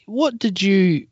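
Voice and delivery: ordinary talk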